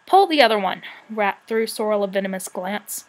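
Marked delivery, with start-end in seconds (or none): 0.1-0.8 loud, scornful comeback; a touch of indignant temper; cross retort. A narrator's reading. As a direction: reject the excuse sharply. Rat snaps back at the dragon.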